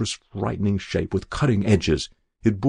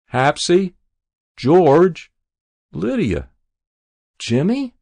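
high, raised calls with questioning lifts; calling out; 8 semitones higher, 6 dB louder, slower than usual. A narrator's reading. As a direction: call each name out, searching for them. Old mother calls for her children.

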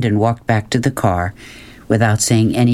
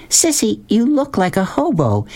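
high-pitched, disapproving mother; high fussing mother's voice; scolding mother; 11 semitones higher than usual. Acted. Mother scolds her daughter.

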